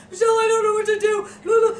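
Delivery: very high, loud, frantic plea; frantic caller impression. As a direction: wail it like a panicked friend phoning. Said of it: "'Cause Amy's, like, calls me up".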